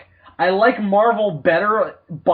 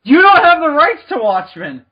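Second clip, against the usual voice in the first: loud, high outraged yell; indignant shout; 10 semitones higher, 7 dB louder than usual. Pretend. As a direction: shout it in disbelief. Arguing as Alan Moore.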